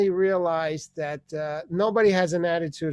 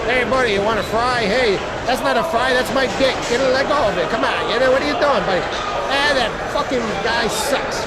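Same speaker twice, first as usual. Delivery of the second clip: raised rowdy spectator chatter; baseball crowd heckler; 3 semitones higher, 4 dB louder than usual. Acted. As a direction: heckle from the stands. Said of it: "you'll usually hear something like".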